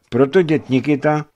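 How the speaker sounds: put-on high, upset boy's voice; tearful. A narrator's reading. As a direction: narrate a boy about to sob. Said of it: "chlapec se rozeštkal". (plačtivě, vysokým hlasem)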